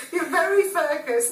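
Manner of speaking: laughing while teasing. Real, not acted. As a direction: say it through a laugh. Teasing co-host about being focused.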